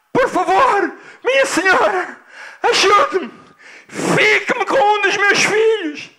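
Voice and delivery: high, loud begging cry; desperate shouting. Acted. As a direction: plead at full voice as a frantic father. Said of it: "aquele senhor vinha a gritar". (súplica aflita aos gritos)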